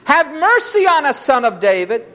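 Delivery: high-pitched plea, falling toward the end; crying out. A narrator's reading. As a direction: call from the roadside for mercy. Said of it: "cried out".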